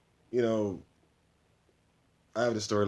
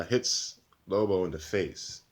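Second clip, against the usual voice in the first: usual speaking voice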